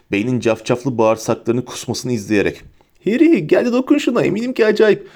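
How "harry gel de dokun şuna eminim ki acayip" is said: high, tipsy coaxing; high giggly excitement; giddy, drunken Ron. A narrator's reading. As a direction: urge a friend on with silly glee. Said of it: "kıkırdayarak, sarhoş gibi yalpalayarak".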